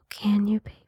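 ordinary talk, nothing marked

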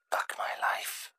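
slow breathless whisper; whispered aside. A narrator's reading. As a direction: mutter it under your breath. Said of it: "Chrysalis broadcasted over the entire hive".

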